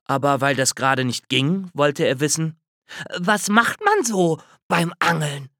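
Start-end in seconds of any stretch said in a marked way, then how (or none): 3.2-5.5 higher, lightly voiced curious question; curious squirrel. A narrator's reading. Squirrel asks the bear about fishing, curious. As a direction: ask like a wide-eyed squirrel.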